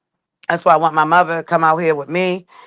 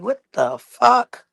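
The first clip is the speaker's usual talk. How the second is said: higher and slow, drawn-out disbelief; groggy shock; 6 semitones higher, much slower than usual. Retold or imitated. Waking to gagging in the jail cell, slow confused shock. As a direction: wake up confused to a strange noise. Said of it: "So I'm like".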